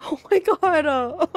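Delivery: half laughing, half crying; tearful laugh while gaming. Real, not acted. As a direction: overcome and breaking up. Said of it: "I'm literally crying".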